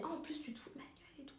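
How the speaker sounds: whispered mock accusation. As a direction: hiss it back like she's offended. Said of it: "Elle me dit". (chuchotement moqueur de l'agresseuse)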